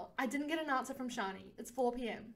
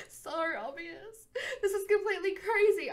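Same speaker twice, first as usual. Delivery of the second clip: half-crying laugh; 7 semitones higher, 3 dB louder than usual. Acted. Stressed student has a sudden idea.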